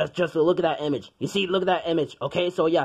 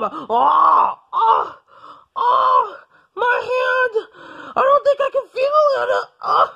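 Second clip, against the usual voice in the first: high, pained whimpering; hammed-up agony, trailing into sobs; moaning in pain; 13 semitones higher, 4 dB louder, much slower than usual. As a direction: whimper over a crushed hand. Doctor moans over crushed hand.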